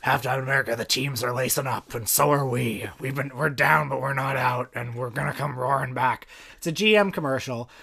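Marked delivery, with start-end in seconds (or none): 0.1-6.2 understated movie-star narration; hint of a screen icon rallying the nation; lightly imitated ad voiceover. Co-host reciting the star's Super Bowl commercial, lightly imitated voiceover. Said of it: "and he says".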